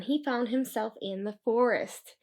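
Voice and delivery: ordinary delivery, nothing marked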